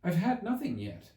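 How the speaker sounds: put-on lightly offended girl's retort. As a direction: answer back, a little affronted. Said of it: "in an offended tone".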